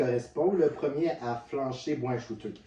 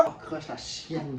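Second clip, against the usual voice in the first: normal talking voice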